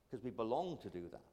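put-on low, quick, soft Cornish accent. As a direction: lightly imitate Nan's Cornish accent, dropped low and quiet. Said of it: "in this Cornish accent".

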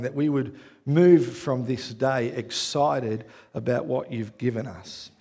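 soft, low, unhurried prayer voice; hushed opening prayer to the Father. Acted. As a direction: pray it quietly and earnestly. Opening prayer before sermon.

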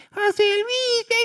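very high, drawn-out call of a mother; mother yelling her son's name from the street; shrill mother's yell. Retold or imitated. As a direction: screech the name like a mom calling from the doorway. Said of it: "empezaba a gritar con esa voz tipluda".

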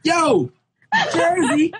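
high, loud hype; leading off the Jersey story with a sudden high, loud hype; excited. Real, not acted. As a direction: burst in hyped up about Jersey.

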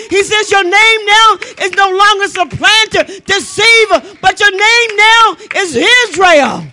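high, driving sermon climax; full-force preaching. Real, not acted.

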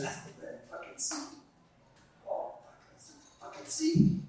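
very soft, near-whispered amazement; stoned-sounding hushed wonder; hushed awe. Acted. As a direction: whisper it as if stunned by the view. Another friend's awe at the sea.

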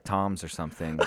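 ordinary talk, nothing marked

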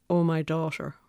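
usual speaking voice

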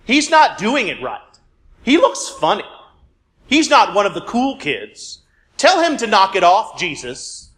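put-on high tattling child voice; whiny schoolkid. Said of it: "Their tone is elementary school-esque".

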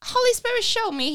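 high mock plea; pleading. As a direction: beg to be shown, high. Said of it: "I said".

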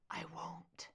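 soft unvoiced whisper. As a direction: breathe the words into her hair. Whispered into her hair.

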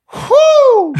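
very high, loud "whew"; high whoop. Acted. Friend acting out a prison story, high loud whoop. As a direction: let out a piercing high whoop. Wordless exclamation in acted scene.

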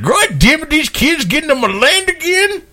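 put-on high cranky old prospector voice. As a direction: grumble like an old gold miner. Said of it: "some old prospector".

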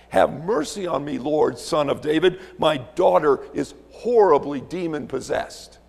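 loud, acted plea for mercy; desperate cry. Preacher voicing the woman crying out to Jesus, loud desperate plea. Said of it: "in that kratzo voice, cries out".

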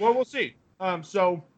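talking through a mouthful; words round a bite of food; mouth full. Real, not acted. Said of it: "sorry, I'm eating".

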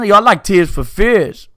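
a hammed-up Brooklyn dude; Brooklyn accent. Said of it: "dudes from Brooklyn just busting out".

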